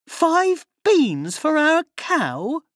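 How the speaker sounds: high, slow shocked mother; outraged cry. A narrator's reading. Mother shocked that Jack traded the cow for beans, high outraged cry. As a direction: shriek it in disbelief. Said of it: "cried his mother".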